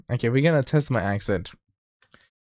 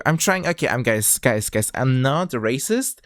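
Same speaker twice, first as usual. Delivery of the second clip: normal talking voice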